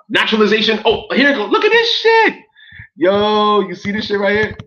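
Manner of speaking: high-pitched, loud excited outburst. Real, not acted. Vlogger finding the oath online, shouting excitedly. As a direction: voice jumping up in real excitement. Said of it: "I'm getting a little excited right now".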